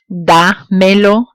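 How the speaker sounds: Dámelo slowly in pieces, da, then melo; slow Dámelo with a gap after da, rising; pronouns held apart. Shown as a demonstration. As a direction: split Dámelo, ending upward. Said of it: "when pronouns are added to a verb form".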